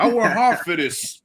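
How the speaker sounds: quoting Tony's comeback through a laugh; Tony Montana quote. Retold or imitated. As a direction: throw back the insult like Tony, half laughing. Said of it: "remember when she called him a spick".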